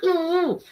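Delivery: sharp high ooh of sudden pain. Retold or imitated. Woman retelling a sharp shoulder pain, high ooh. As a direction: yelp high at the jab of pain.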